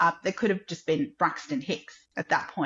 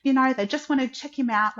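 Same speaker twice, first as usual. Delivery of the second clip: higher, reassuring midwife voice; student midwife calming the scared mother, higher and reassuring; brave-faced comfort; 7 semitones higher, faster than usual. Retold or imitated. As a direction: soothe her like a kind student midwife. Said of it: "she just put on a brave face".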